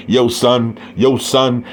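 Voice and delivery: lightly put-on Brooklyn slang greeting; a hint of New York street talk, tossed off; mock New York accent. Mocking a fighter who talks like he's from Brooklyn, light New York accent. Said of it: "I ain't gotta talk like that".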